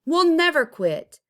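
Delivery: high, loud vow; defiant shout. A narrator's reading. Neighbors vow to fight the city, high and loud. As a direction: vow it with fire. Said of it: "they said".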